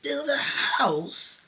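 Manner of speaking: high, slow, whispered astonishment; stunned disbelief; exclaiming. A narrator's reading. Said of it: "exclaimed John".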